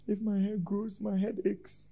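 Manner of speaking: soft, high voice mimicking a complainer; offended church member. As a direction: make the excuse in a small, high voice. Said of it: "Some people feel insulted".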